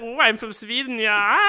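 high, exaggerated German accent posing as Swedish; mock German accent. Host mocking people faking Swedish with a German accent. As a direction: put on a bad German accent, pitched high. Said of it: "people were doing German accents all over the place".

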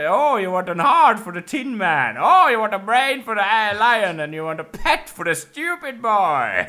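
high, loud hammed-up wizard; grand wizard voice. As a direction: announce the gifts like a showy wizard. Playing the Wizard granting wishes.

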